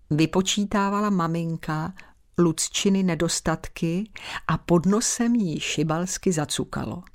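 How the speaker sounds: plain reading voice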